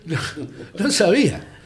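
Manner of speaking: laughing through the words, amused; real amused laugh while saying it. Real, not acted. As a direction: chuckle it out, remembering his own innocence. Laughs recalling his own naivety.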